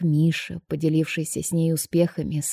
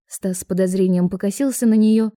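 ordinary delivery

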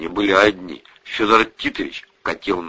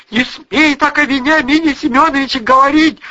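high, loud indignant mother; angry rebuke; 6 dB louder than usual. A narrator's reading. Mother defends her lover to daughter, outraged. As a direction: forbid it at the top of the voice.

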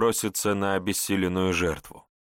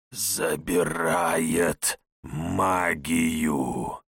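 low, very slow rasping shadow; creeping nightmare whisper; 5 semitones lower, much slower than usual. Acted. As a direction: rasp it out like a dark shade. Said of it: "прохрипела тень".

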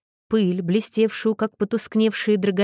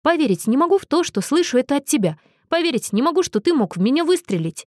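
higher, quick, incredulous exclamation; indignant disbelief, pitched up and hurried; exclaiming; 6 semitones higher, faster than usual. A narrator's reading. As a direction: protest in disbelief at a friend. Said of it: "воскликнул Джеймс".

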